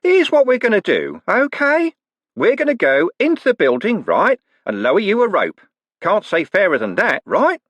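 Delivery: high, loud falsely cheery shouting; cheery bellow. Acted. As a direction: bark the terms like a friendly thug. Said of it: "a cheery voice bellowed".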